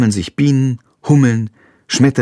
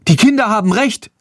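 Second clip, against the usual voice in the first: put-on young woman calling; 10 semitones higher than usual. A narrator's reading. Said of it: "ruft eine junge Frau".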